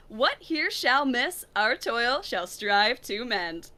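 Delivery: theatrical Shakespeare recital, measured and rising; a stage reading for the stream chat; dramatic prologue reading. Acted. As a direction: declaim the prologue like a Watch Mojo host. Reads the Romeo and Juliet prologue.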